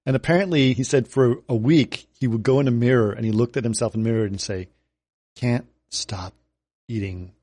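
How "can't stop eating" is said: slow low deadpan; slow deadpan read; Nick Offerman deadpan. Retold or imitated. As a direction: quote the tweet dead serious to a mirror. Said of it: "Nick Offerman way".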